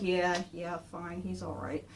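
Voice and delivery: low, quiet grudging agreement; grudging Raph. Retold or imitated. Raph conceding to his brother, low grudging murmur. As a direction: give in reluctantly, dropping low and soft. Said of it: "He's going like".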